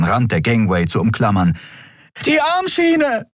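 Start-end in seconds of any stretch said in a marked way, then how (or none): 2.3-3.3 very high, drawn-out yell; vocal-cord-tearing, high-pitched scream; desperate scream. A narrator's reading. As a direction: scream to be heard over engines. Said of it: "schrie Andriel, dass es ihr fast die Stimmbänder zerriss".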